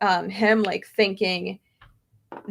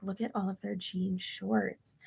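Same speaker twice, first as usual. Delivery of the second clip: much softer, murmured aside; theater aside; 2 semitones lower, 13 dB quieter than usual. Retold or imitated. Commenting to Tony in the empty theater, murmured. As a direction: lean over and keep it quiet. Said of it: "I was like".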